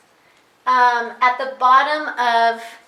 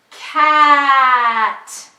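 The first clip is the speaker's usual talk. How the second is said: drawn-out sounds, louder, without a break; stretched slow blend; 10 dB louder than usual. Shown as a demonstration. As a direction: stretch every sound but keep them joined. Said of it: "do it again".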